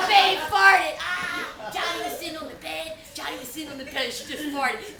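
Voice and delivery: high, loud tattletale announcement, imitating brothers; crowing it to the whole house; mock gleeful yelling. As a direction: shout out the embarrassing news. Brothers' gleeful announcing of fart, retold.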